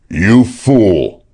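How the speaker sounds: loud snarled insult; snarling. A narrator's reading. Pennington at Sexton, snarling. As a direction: snarl it at him. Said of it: "he snarled".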